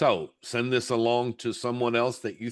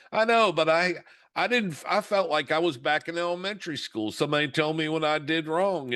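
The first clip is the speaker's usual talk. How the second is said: higher, frustrated venting; upset daughter; 6 semitones higher than usual. Retold or imitated. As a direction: vent like a stung young employee. Daughter upset about eval.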